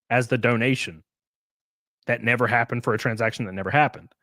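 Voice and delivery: ordinary delivery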